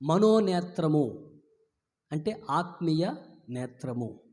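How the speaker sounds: ordinary talk, nothing marked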